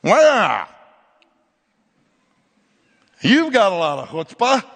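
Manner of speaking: bristling snap back at him; hammed-up offended retort; indignant retort. His own retort retold.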